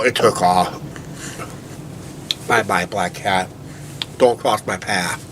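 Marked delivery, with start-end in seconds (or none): none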